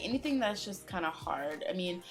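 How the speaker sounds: normal talking voice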